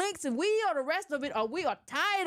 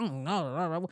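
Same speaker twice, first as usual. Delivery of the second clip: much lower muttered reply; grumbling voter; 10 semitones lower, slower than usual. Retold or imitated. Voter grumbles back at poll worker, low mutter. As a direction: mutter it deep and confused. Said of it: "what you grumbling about".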